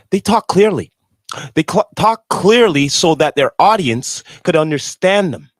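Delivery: loud, driving the point home; loud emphatic. Real, not acted. Stresses the point about clarity.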